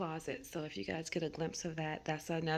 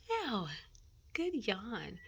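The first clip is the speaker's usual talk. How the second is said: cooing at dog; 3 semitones higher, much slower than usual. Real, not acted. Commenting on dog's yawn.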